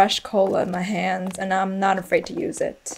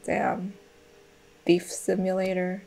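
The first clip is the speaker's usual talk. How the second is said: normal talking voice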